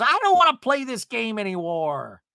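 high, loud whine trailing down; hammed-up fed-up complaint; mock whining. [name] quitting the game, mock whine.

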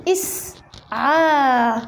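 اسعى stretched very slowly, each sound held; teacher spells out اسعى slowly for the class; drawn-out demo word. Shown as a demonstration. Teacher to her girl students, slow stretched demo. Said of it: "اسعى أصلها".

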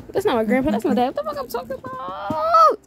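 high, rising outburst ending in a squeal; flustered self-correction. Real, not acted. Catching their own mistake about grandpa.

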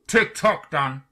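high, loud call, dropping on the name; loud singled-out taunt; taunting shout. A narrator's reading. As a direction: shout a mocking countdown. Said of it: "Again he shouted".

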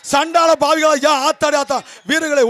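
usual speaking voice

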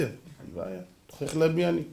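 soft, unruffled reply; calm acceptance. Retold or imitated. Rabbi answering the father's threat, quiet and calm. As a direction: drop to a quiet, untroubled answer.

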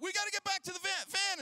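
high, loud, fast urging; panicked shout. Retold or imitated. Dad urging the family back to the van, shouting fast. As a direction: hurry everyone to the van, shouting. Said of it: "I'm like".

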